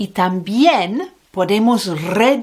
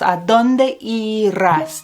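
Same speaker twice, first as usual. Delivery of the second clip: "¿A dónde irás?" asked slowly, rising on "A"; slow clear model. Shown as a demonstration. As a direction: spell the question out slowly for learners. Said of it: "No sé dónde vas".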